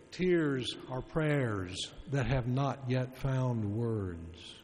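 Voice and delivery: usual speaking voice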